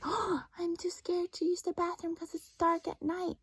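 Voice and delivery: high, whispered mock fear; child afraid to go to the bathroom at night; scared little kid. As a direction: whisper like a frightened six-year-old. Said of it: "I would be like".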